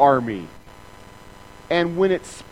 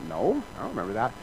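lower, quick, baffled; genuinely unaware; lightly played puzzled believer; puzzled denial; 5 semitones lower, 5 dB quieter, faster than usual. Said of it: "you're like".

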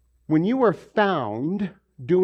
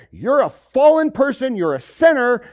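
higher, louder accusing voice; mock accusing shout; 8 semitones higher, 8 dB louder than usual. Preacher mocks Christians calling the world fallen, loud accusing shout. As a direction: point the finger and shout it.

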